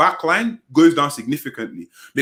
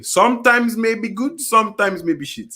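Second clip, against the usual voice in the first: slow, higher, each half spelt out; said again deliberately; 6 semitones higher, slower than usual. Real, not acted. Co-host repeats his muttered jibe for the listeners, slow and deliberate. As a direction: repeat it slowly and clearly for everyone to hear. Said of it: "I will say it again".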